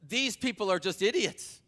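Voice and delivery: high, scornful jeer at believers; scornful mockery. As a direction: sneer it like a mocking bystander. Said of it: "this is what they're saying".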